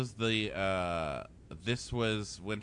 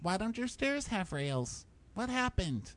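much higher, slow girly mock voice; high mock girl voice asking a question; mock JoJo; 12 semitones higher than usual. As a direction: squeak it out like a baffled bachelorette.

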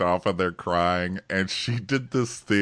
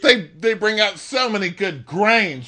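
much higher, louder gushing voice; mock gushing contestant; 9 dB louder, slower than usual. Parodying contestants loving Milwaukee, high gushing. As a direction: gush like an airheaded fan. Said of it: "mock contestant gushing".

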